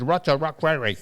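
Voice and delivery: imitated Japanese accent, L turned to R; mock Japanese accent. A listener reports the code to his sir, mock Japanese accent. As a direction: report "Lots of luck, Larry" as "Rots a ruck, Rarry". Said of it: "they'd write it down and they'd say".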